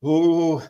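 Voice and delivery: high, loud held "ooh"; mimicked nervous "ooh". Dad retelling his son being strong before a shot, mock nervous ooh. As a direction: imitate a kid bracing himself.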